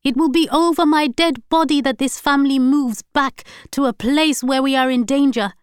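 loud, high angry voice; furious shouting. A narrator's reading. Wife confronting husband about his lies, shouting. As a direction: accuse him relentlessly.

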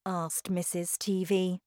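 ordinary delivery

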